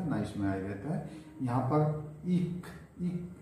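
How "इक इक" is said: इक said slow and breathy as a short i; इक, इक with a clipped i; short ik sound. Shown as a demonstration. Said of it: "ई से, ई".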